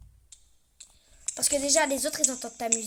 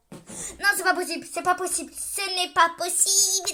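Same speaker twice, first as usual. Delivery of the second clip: loud frustrated protest, slowing on the last one; loud exasperated rant; raging; 5 semitones higher, 6 dB louder than usual. Real, not acted. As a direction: rage at the game, louder each time. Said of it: "laissez-moi rager en fait".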